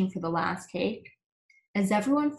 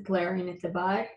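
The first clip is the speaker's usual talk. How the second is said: usual speaking voice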